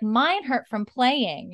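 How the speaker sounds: put-on high, slow small child asking; little boy voice. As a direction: play a curious toddler, drawn out. Child Germain voiced again.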